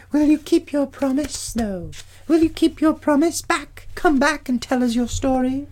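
very high, pleading nymph voice; high nymph calling after a departing friend. Acted. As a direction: beg him to return in a high-pitched little voice. Nymphs plead with Perseus to return.

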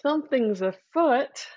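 usual speaking voice

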